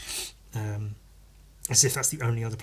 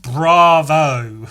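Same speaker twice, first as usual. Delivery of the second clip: loud, drawn-out mock praise; hammed-up congratulation for nothing; sarcastic bravo; 3 semitones higher, 9 dB louder than usual. Mock applause for finishing school.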